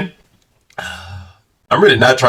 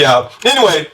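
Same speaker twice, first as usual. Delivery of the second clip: normal talking voice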